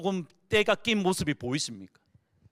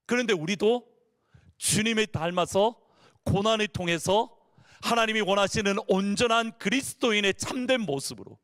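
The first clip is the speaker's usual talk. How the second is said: rising, sing-song sermon voice; fervent preaching; 5 semitones higher than usual. Acted. Pastor exhorting the congregation, fervent and rising. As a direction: lift the congregation with every phrase.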